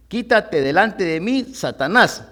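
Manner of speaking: stern command, loud and rising; Jesus rebuking. Acted. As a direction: cast Satan out in a commanding voice. Preacher voices Jesus rebuking Peter.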